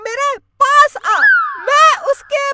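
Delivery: high, loud witch voice; high-pitched witch declaiming; menacing witch. Acted. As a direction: address the couple as a theatrical witch. Witch tells her story.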